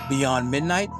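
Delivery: ordinary delivery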